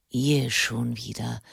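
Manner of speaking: low grumble, lightly voiced old monk; a hint of a grumpy hermit; grumbling. A narrator's reading. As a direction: mutter it with irritation. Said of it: "brummte William Fleet".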